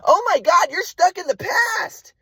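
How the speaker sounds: very high, drawn-out mock wail as mom; exasperated mother imitation. Mom cutting off son's explanation, exasperated high mock wail. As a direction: mimic mom's high-pitched exasperation, stretching the end. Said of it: "And my mom's like".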